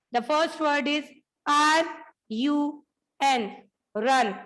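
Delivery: letter by letter for the class; spelled out letters. Shown as a demonstration. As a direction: spell each letter slowly, then say the word. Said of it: "spelling aloud for class". (अक्षर-अक्षर बोलकर)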